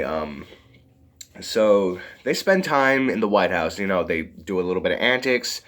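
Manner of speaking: usual speaking voice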